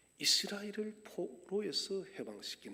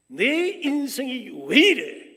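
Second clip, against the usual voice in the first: slow, much louder and higher cry; exasperated outcry; 6 semitones higher, 18 dB louder, slower than usual. Retold or imitated. Someone unhappy with their own life, slow exasperated shout. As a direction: throw the question out in frustration. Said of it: "하는 질문을 던지는".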